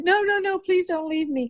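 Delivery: very high, begging voice; mock panicked pleading. Overwhelmed official begs her not to leave, high mock-panicked plea. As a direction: imitate a man frantically begging.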